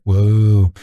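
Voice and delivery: normal talking voice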